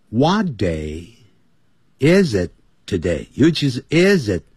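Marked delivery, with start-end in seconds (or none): 3.9-4.4 is it run together as one word; linking is it like a teacher; linked sounds. Shown as a demonstration. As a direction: glide is into it with no gap. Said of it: "要连在一起，要发出连音".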